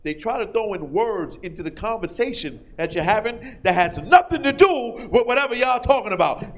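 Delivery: ordinary delivery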